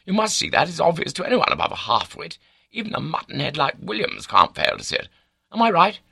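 high, insistent, exasperated urging; impatient. A narrator's reading. Baronet demanding his confidant agree, impatient. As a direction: hurry him along, voice pitched right up.

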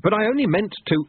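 high-pitched protest. A narrator's reading. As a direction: object as a flustered apprentice. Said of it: "Lobsang protested".

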